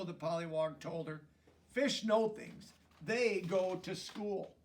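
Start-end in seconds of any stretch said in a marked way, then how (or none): 1.7-4.6 earnest explaining. Acted. Pollywog explains solemnly.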